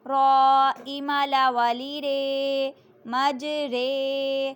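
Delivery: slow chanted spelling of مجرہا; spelling aloud. Shown as a demonstration. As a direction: spell out letter by letter, teacher style. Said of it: "امالہ کے ہجے اس طرح کریں".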